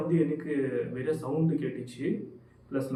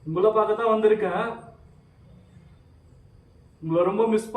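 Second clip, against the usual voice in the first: raised, higher voice addressing an unseen presence; calling out; 6 semitones higher, 7 dB louder than usual. Real, not acted. Ghost hunter calls to Maya inside the haunted house, calling out. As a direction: call loudly into the house, building in volume.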